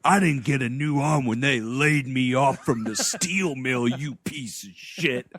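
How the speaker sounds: low, put-on barfly grievance; hammed-up embittered bar drunk; bitter. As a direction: grumble resentfully, slowing down on the insult. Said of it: "he's, like, bitter about it".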